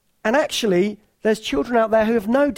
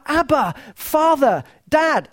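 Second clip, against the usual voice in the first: rising heartfelt cry; 6 semitones higher, 3 dB louder, slower than usual. Pretend. Spirit crying out to Father.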